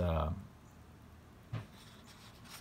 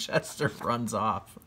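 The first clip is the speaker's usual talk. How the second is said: usual speaking voice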